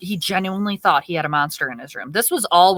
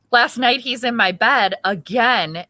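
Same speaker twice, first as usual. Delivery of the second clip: ordinary talk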